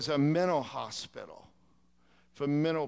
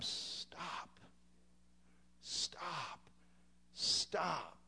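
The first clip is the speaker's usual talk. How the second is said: very soft, drawn-out cries; hushed mock scream; 14 dB quieter, much slower than usual. Preacher urges brethren to warn the lost, hushed mock scream. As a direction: scream it under your breath, each word falling away. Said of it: "we gotta scream".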